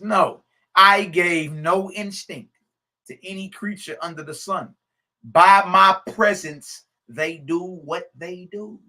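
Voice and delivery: slow, loud declaration of divine words; proclaiming scripture. Acted. Preacher reading the creator's words to his audience, proclaiming. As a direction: read it as the creator's decree.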